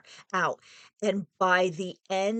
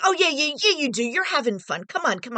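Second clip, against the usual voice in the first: loud, higher, pushy insistence; pushy brushing off; 6 semitones higher, 4 dB louder, faster than usual. Retold or imitated. Boyfriend brushing off her refusal, pushy and loud. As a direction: wave off her no and keep pushing. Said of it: "he'd be like".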